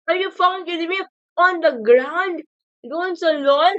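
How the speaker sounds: loud, high, outraged disbelief; can't believe what just happened; shocked outrage. Real, not acted. Absurd wave of Dolphin Riders.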